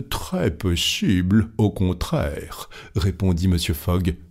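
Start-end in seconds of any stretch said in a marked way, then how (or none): 0.1-2.7 slow, measured reply; calm contradiction. Acted. Fogg contradicts Stuart's bet, slow and calm. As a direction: take your time, unruffled.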